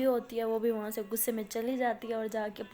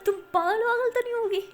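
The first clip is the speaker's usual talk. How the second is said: exasperated disbelief; 7 semitones higher, 5 dB louder than usual. Acted. Aliya exasperated at Maya's confession.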